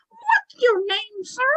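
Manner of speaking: high, slow puppet question; drawn-out childlike falsetto; Tommy. Acted. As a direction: politely quiz in a squeaky spirit voice. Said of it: "And then he says".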